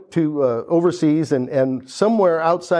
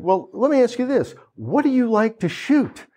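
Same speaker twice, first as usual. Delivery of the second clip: higher, knowing salesman voice; 8 semitones higher than usual. Retold or imitated. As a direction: lead him with a question. Salesman questions him.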